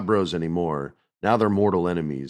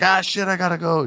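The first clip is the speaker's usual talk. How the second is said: high hurried mock Kairi; Kairi voiced high; 12 semitones higher than usual. Kairi answering Asuka's call, high mock hurry. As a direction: squeak it out, rushing off. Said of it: "And Kairi's like".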